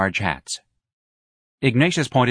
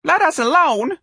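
very high, loud shrill cry; shrill lady; 6 dB louder than usual. A narrator's reading. A lady shrieks at Ignatius to leave them. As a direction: shriek at him to go. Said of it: "a shrill voice said".

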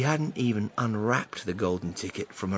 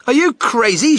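much higher, much louder outburst; indignant shout; 12 semitones higher, 11 dB louder than usual. Acted. A woman shouts at the man bidding for the ticket. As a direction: shout him down. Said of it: "shouted the woman".

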